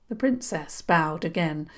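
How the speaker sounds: plain reading voice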